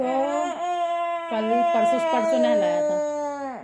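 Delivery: whiny. Acted. Whiny complaint about the dog.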